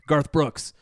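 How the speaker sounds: loud, mock-gruff name bark; playful gruff summons; Bruce Wayne. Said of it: "saying it gruffly".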